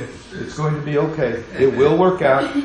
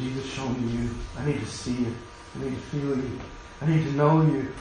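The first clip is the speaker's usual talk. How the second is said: softer, pleading prayer voice; 8 dB quieter than usual. Shown as a demonstration. Pastor models a private prayer for listeners, softly pleading. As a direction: quietly beg to see and feel Him. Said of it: "have a talk and say".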